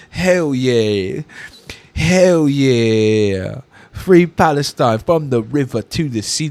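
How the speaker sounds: hammed-up lazy drawl copying his inflection; mocking imitation of the singer. Mocking the punk singer's chant to his viewers, hammed-up drawl. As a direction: mimic the singer's smug chant, drawn out. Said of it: "the inflection in which he says it".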